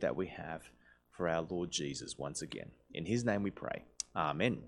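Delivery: low, soft, pausing prayer; hushed opening prayer, falling to a low amen; public prayer. Real, not acted. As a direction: pray quietly and reverently on behalf of the congregation.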